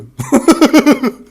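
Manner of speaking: shrill laugh of relief after a fright; high nervous laugh. Real, not acted. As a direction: laugh out the fear, high and loud. After jump scare.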